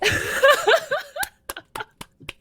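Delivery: loud high laugh. Real, not acted. Laughing at the winner announcement.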